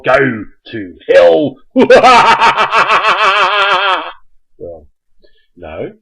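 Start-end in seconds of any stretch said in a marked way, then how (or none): 0.0-1.5 slow, louder, menacing god voice. Pretend. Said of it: "look menacing like this". 1.6-4.4 loud booming villain laugh; menacing god laugh. Pretend. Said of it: "look menacing like this".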